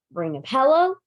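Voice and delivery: usual speaking voice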